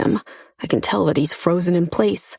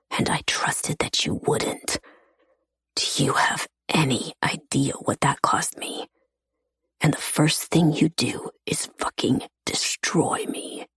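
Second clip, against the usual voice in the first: hurt whisper; 3 semitones lower, 6 dB quieter than usual. A narrator's reading. Pax's hurt confession, whispered.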